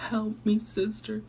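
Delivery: high, slow whiny fake sob; mock crying. Mocking Rosy begging her sister, whiny fake crying. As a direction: hams up a fake tearful plea. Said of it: "Le está como llorando".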